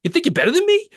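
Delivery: put-on loud, high, challenging Boston accent; Boston tough guy. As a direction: pick a fight as an indignant Boston guy. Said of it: "about Boston guy being like".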